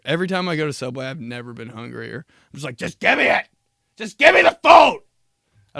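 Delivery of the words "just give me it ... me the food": higher and louder, building to a yell; hungry shout. Retold or imitated. Starving customer yelling at the Subway worker for food. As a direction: demand the food like you're starving.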